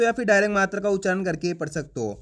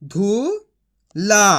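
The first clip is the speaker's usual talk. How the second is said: sounding out; 3 dB louder than usual. Shown as a demonstration. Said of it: "तो मिलके बन जाएगा".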